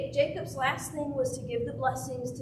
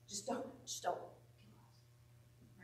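slow, hushed whisper of a frightened plea; 4 semitones lower, 13 dB quieter, much slower than usual. Acted. Guilty brothers pleading with Joseph, slow frightened whisper. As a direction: beg for your life under your breath, slowly.

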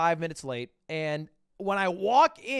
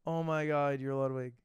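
imitating an unimpressed fan, low monotone; underwhelmed fan; 4 semitones lower, 5 dB quieter than usual. Concession worker recognizing the streamer, imitated as underwhelmed. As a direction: retell a fan's greeting with no excitement at all. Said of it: "I'm not really changing the tone".

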